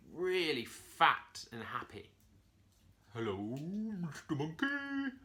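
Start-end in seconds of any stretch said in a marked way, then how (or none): 3.1-5.2 slow, higher hippo voice calling out. A narrator's reading. Hippo calls a greeting up to the monkey, slow and high. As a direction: call out slowly like a big fat hippo. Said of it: "he called out from his pool".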